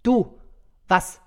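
two short, loud bursts; loud demand. Acted. Santa pointing at the nearest elf, demanding loudly. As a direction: fire it at the culprit, loudly.

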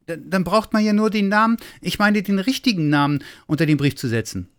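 a hint of a curious little boy asking; little Weihnachtsmann. A narrator's reading.

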